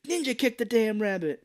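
high, mock-impatient yell at the TV; hammed-up heckling shout; imitated yelling. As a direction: act out a turtle shouting at a cartoon. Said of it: "yelling at the turtle to hurry up".

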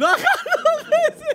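high laughing disbelief, slow. Real, not acted. Preacher to audience, laughing in disbelief. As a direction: laugh through the words.

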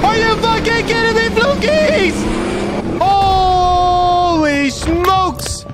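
high, drawn-out excited yell. Real, not acted. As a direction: shout out in disbelief. Big gift sub reaction.

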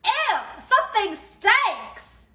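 high, drawn-out disgusted whine; acted grossed-out outcry. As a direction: recoil from a foul smell and complain. Passenger disgusted by a smell.

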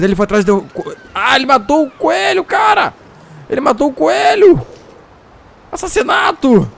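loud, very high shocked yell; shocked shout. Real, not acted. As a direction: shriek it in disbelief. Wolf kills rabbit, shocked.

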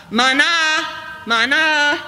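imitating the tourist's high call of mana. Tourist mimics shepherd calling sheep, mock high call. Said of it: "he called out".

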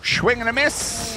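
high-pitched sporting call; excited play-by-play call. Real, not acted. Caster calling Obi's missed swing, excited. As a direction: sing out the miss like a commentator.

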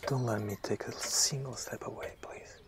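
soft, whispered begging; whispered pleading. Acted. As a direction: beg her under your breath.

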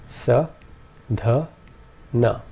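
sa, dha, na said one by one; syllables apart. Shown as a demonstration. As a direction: sa dha na, pausing between each. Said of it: "our three syllables are".

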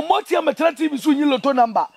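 normal talking voice

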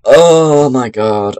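slow, drawn-out groan of words; slow fed-up oh my god; exasperated. Real, not acted. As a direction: drag it out in defeat. Frustrated after failing again.

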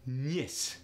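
"Nis" with a short Russian glide; brief y-glide on "Nis", Russian accent; lighter Russian accent. Shown as a demonstration. [name] showing accent thickness, lighter Russian accent. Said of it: "It depends on the thickness".

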